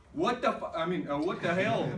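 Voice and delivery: loud, high exasperated outburst; re-enacted angry demand; out of patience. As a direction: blurt it out, acting fed up. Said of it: "I was really nervous, without any patience".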